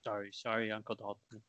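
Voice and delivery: very soft, low, sheepish sorry; meek quiet apology. Acted. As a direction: shrink back after being scolded. Teen scolded into silence.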